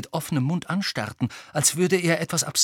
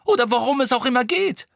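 high, exasperated questioning; insistent; 11 semitones higher, 6 dB louder than usual. A narrator's reading. Hermine questions why Voldemort needs Sirius, insistently. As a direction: press hard, rushing the final aside. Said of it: "beharrte Hermine".

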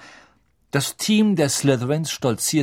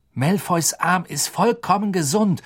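put-on angry teenage boy, raised voice; 7 semitones higher than usual. A narrator's reading. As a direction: protest it hotly. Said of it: "sagte Harry zornig".

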